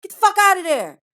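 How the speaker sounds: loud, high furious yell at her; imitating him shouting in rage; angry yell. Boyfriend catches her in his console, yelling furiously. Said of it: "he yelled".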